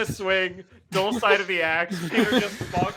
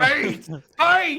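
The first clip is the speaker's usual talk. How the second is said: high, loud comic battle shouts; shouting; 8 semitones higher, 9 dB louder than usual. Acted. Player as Peter shouts while pointing to him on the ground, shouting. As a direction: shout each one like a rallying cry. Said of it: "Peter just starts shouting beans".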